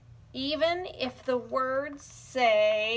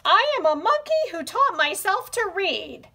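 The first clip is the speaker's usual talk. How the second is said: monkey voice; 7 semitones higher, 6 dB louder than usual. Acted. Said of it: "in my monkey voice".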